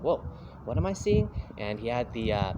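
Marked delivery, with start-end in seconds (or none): 0.0-1.3 mock amazed. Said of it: "I was like".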